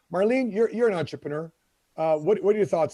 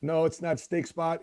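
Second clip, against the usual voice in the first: usual speaking voice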